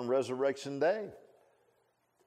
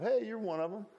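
high, pointed accusing voice; a little maid calling out Peter; accusing servant girl; 12 semitones higher than usual. Acted. Maid accusing Peter by the fire, high and pointed.

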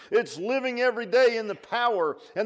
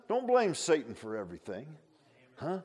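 ordinary talk

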